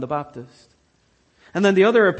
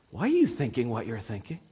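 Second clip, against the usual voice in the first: probing question; 5 semitones lower, 6 dB quieter, faster than usual. Acted. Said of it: "Jesus points to them and says".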